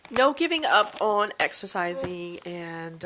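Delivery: normal talking voice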